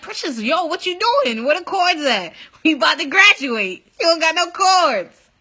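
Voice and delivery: worked-up mock complaint; imitating demanding classmates. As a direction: mimic classmates hounding you for something. Classmates demanding their cords.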